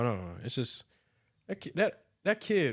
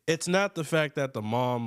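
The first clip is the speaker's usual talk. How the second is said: usual speaking voice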